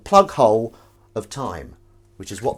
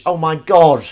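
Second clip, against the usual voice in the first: excited exclaim; 5 semitones higher, 11 dB louder, slower than usual. Real, not acted. Exclaims at the end.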